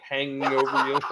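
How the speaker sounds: usual speaking voice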